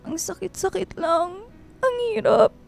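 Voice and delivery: quiet, tearful and hurting; crying softly. Acted. Girl confides in her friend, quiet and crying. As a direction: let the words break under tears.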